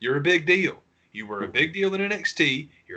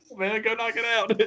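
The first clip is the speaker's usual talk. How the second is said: mock encouragement; 9 semitones higher than usual. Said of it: "it's like".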